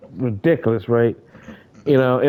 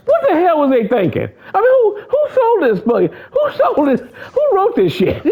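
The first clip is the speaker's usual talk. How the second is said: outraged disbelief. Real, not acted. Fan ranting at who wrote the sequels, high outraged voice. Indignant rant at writers.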